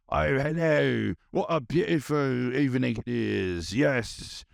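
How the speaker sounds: put-on British accent, stagey and a bit hammed-up. Masked stand-up alter ego welcoming the crowd in a British accent. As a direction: greet the room like a grand English host, British accent. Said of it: "like, a British voice".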